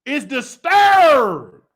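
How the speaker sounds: drawn-out shouted warning, slow. Pretend. Reading tarot cards, she shouts the verdict slowly. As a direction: stretch it out and shout it.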